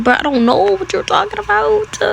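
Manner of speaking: very high-pitched feigned ignorance; mock denial. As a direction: protest innocence in a squeaky high voice. Acted denial to the parents.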